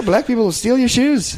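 mocking the lady. Mocking the lady's line.

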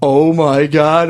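drawn-out, amazed retelling; mock awe. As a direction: stretch it slowly in acted wonder. Acts out awe in dream.

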